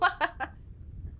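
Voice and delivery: high, airy giggle; real laugh. Real, not acted. Streamer amused at the cheese in her face, giggling. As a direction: let a light high laugh trail out.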